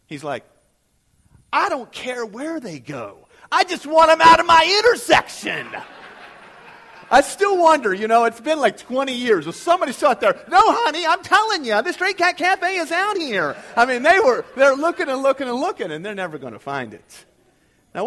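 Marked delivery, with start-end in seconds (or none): 1.5-5.7 rising to a loud, higher laughing blurt; blunt retort. Retold or imitated. Trooper telling the guardsman he only wants cars gone, loud laughing retort. 10.5-13.6 louder, higher exasperated insisting. Pretend. Lost husband insisting to his wife, loud exasperated.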